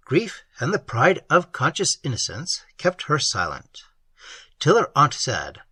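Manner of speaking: ordinary delivery, nothing marked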